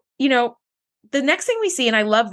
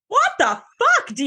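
shocked outburst; 11 semitones higher than usual. Retold or imitated. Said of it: "And I was like".

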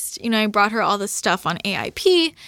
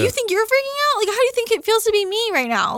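high-pitched frustrated protest; indignant, voice pitched way up; exasperated pleading; 10 semitones higher than usual. Retold or imitated. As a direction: plead your case in exasperation. Retold argument with dad.

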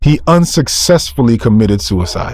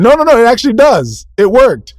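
high, loud emphatic no no no; preacher firmly contradicting the doubters; emphatic insistence; 11 semitones higher, 4 dB louder than usual. Real, not acted. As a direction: push back hard, rising up. Preacher insists it really works.

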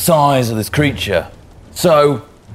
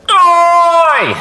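high, loud hailing shout; 6 dB louder than usual. Acted. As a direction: yell to catch a distant crew's attention. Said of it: "Oh, now he's shouting".

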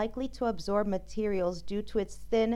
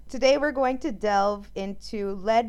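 ordinary talk, nothing marked

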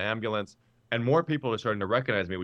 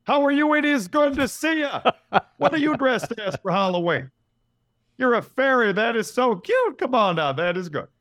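much higher, louder and chuckling; higher Obama with kids; 9 semitones higher, 5 dB louder than usual. Acted. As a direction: delight a child at Halloween. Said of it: "I might get a little higher pitched".

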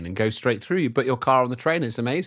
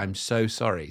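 professional apology; 5 semitones lower, 5 dB quieter than usual. Retold or imitated. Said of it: "job was as a professional apologizer".

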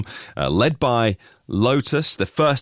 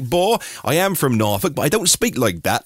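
higher-pitched, put-on Norfolk lilt; Norfolk accent; 5 semitones higher than usual. Shown as a demonstration. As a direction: slip into a demonstrated Norfolk accent. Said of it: "I haven't got the Norfolk accent, or I can still do it".

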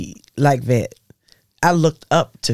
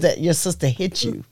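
ordinary talk, nothing marked

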